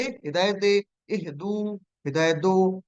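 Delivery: normal talking voice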